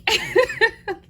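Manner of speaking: high giddy laugh; burst of laughter. Real, not acted. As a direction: laugh at your own boast. Laughing about feeling she can fly.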